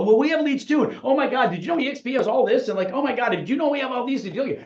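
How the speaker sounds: very high, gushing mock excitement; hammed-up high-pitched eager pitch; over-eager. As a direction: babble like an overexcited recruiter. Mocks overeager agent dismissing a lead.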